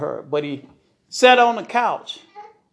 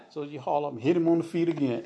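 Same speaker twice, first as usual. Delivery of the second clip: usual speaking voice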